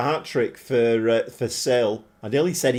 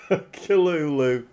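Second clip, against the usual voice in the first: usual speaking voice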